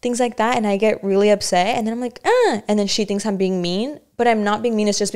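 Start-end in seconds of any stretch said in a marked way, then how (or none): 2.3-2.6 high annoyed "uh"; a sharp fed-up groan; exasperated groan. Retold or imitated. Daughter reacting to mom picking at her clothes, groaning.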